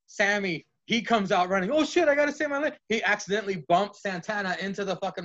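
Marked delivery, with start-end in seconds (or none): 1.7-2.8 high and fast panicked rush; cartoonish high-pitched scramble; mock panic. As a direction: blurt it out fleeing. Sammy running out scared.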